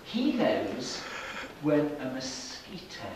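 plain reading voice

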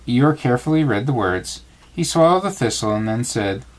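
plain reading voice

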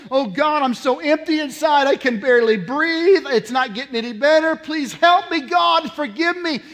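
pleading that builds to a loud, high cry; desperate prayer. Retold or imitated. Lost sinner begging God for help, building desperate plea. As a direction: start contained, climb to a desperate shout.

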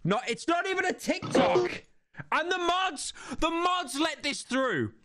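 high, indignant disbelief; outraged. Real, not acted. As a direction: squeal in outrage at the mods. Outraged at a bad clip.

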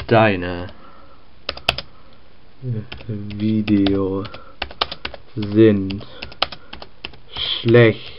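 low, slow, each word spaced out; slow menacing typing voice. Acted. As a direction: drag out every word like a villain. Hater slowly typing nasty comment.

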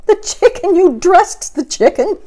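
high, loud horrified question. Acted. Missus Rogers learning the chicken was dressed, high loud horror. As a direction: shriek in disbelief at the chicken. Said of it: "asked Missus Rogers".

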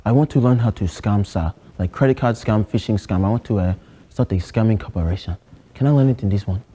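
put-on lightly held Nigerian accent, earnest student; a Nigerian student pleading with the teacher. To a geography teacher, a mild Nigerian accent. Persona asks security class.

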